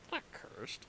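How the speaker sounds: whispered, unvoiced. Real, not acted. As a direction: say it under your breath. Whispered aside.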